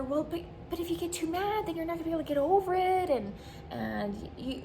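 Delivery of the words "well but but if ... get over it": mock lecturing friend.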